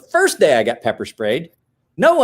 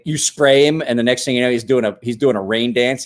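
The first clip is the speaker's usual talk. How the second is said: usual speaking voice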